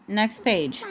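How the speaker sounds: ordinary delivery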